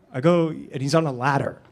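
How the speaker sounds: usual speaking voice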